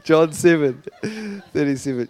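trying to talk through laughter; real giggles. Real, not acted. Preacher returns to John seven after funny message, giggling. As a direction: struggle to get back on track, giggling.

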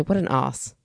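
Australian accent, "What an os"; Australian accent dropping the R in "os". Shown as a demonstration. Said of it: "No, they say".

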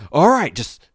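loud mock director shout; mock director yell. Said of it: "I wanted the director to scream out".

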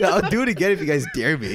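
mock eagerness through laughter; giggly imitation. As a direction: imitate him fishing for a dare, laughing. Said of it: "the first time he's like".

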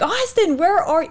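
a hint of frantic hosts hunting for a lost kid; mock worried parents calling. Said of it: "They're like".

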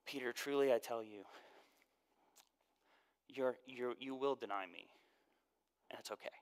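very soft, tender reassurance fading to a whisper; hushed, loving Jesus; gentle, kind. Retold or imitated. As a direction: comfort him gently as you break hard news. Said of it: "We should imagine Jesus saying".

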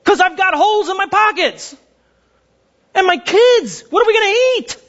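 high-pitched, exasperated plea; desperate complaint. Acted. Broke parent complaining to God, desperate complaint. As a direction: voice climbing in acted desperation. Believer's acted complaint about poverty.

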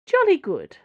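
high, loud, brisk Cardinal; breezily pleased Cardinal; cheerful Cardinal. A narrator's reading. Cardinal character voice.

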